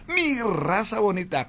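"Mi raza bonita" shouted high and loud like an ad; announcer voice. Shown as a demonstration. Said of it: "pega el anuncio". ("Mi raza bonita" gritado como locutor)

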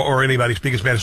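ordinary talk, nothing marked

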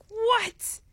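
high-pitched incredulous "what"; shocked. Acted. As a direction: burst out in mock shock, pitch leaping. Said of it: "I'm just shocked right now".

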